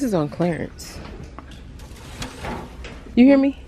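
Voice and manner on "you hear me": calling out. Real, not acted. Calling out to mom.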